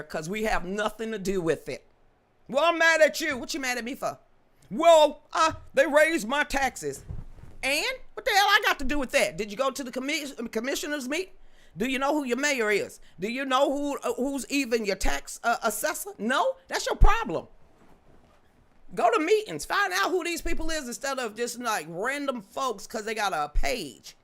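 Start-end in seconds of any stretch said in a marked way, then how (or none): 2.5-9.4 acted quarrel. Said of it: "acted argument with a voter". 9.4-24.2 scolding lecture. Acted. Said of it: "lecturing an imagined critic".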